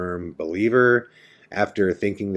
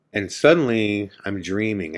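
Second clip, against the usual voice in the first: usual speaking voice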